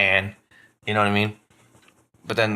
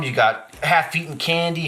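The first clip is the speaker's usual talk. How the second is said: ordinary delivery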